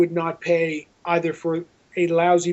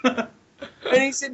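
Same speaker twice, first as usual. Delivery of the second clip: laughing; 6 semitones higher, much slower than usual. Real, not acted. Laughing while retelling the call.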